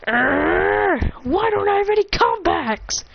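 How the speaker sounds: exasperated, high-pitched acted complaint; frustrated outburst. Trolled Bakugo lacking comebacks, high-pitched frustration. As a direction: growl into a high-pitched mock whine.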